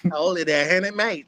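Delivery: hammed-up British "ain't it, mate"; mock British accent. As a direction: tease them in a cockney accent. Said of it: "dumb British accent".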